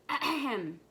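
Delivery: pointed mock "ahem"; throat clearing. Chief frog addresses the visitor, formal throat clearing. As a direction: clear your throat to get attention. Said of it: "the chief frog spoke up".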